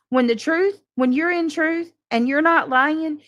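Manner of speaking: ordinary talk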